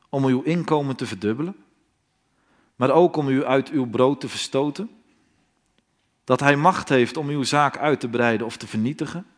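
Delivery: ordinary talk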